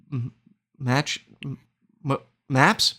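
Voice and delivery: stuttering and stopping over "maps"; false starts on the m, then a questioning "maps"; tongue-tied stumble. Real, not acted. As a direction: trip over the word "maps". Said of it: "stroked out there for a second".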